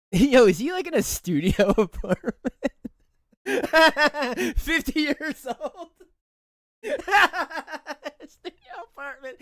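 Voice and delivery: high-pitched laughter bursting through the words; laughing hard. Real, not acted. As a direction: cackle as you say it. Mocking Nick's studio apartment.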